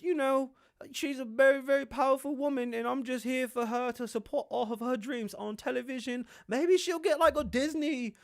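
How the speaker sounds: high, meek put-on prince voice; mock a submissive husband parroting support; henpecked Harry. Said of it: "pussy whooping. He's like".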